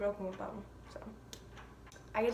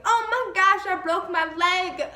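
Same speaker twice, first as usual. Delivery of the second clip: high, loud panicked yell, slower; retold scream; 7 semitones higher, 9 dB louder, slower than usual. Injured girl screaming on the field at practice, loud panic. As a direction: shriek it like the bone just snapped. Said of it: "hear my voice being screamed".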